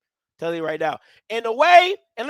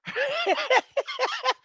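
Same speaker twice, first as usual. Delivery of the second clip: high-pitched giggling fit, softer than speech; high laugh; 13 semitones higher, 7 dB quieter than usual. Real, not acted. Host cracks up at his own teeth joke, high giggle. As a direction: burst into high, quick giggles.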